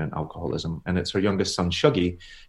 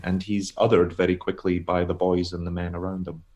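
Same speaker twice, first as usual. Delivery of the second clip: ordinary talk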